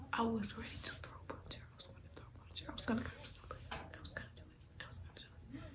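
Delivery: hushed, emphatic whisper; whispered confession of wanting to fight; intense whisper. Retold or imitated. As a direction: whisper it like a suppressed threat. Telling her suppressed urge to fight.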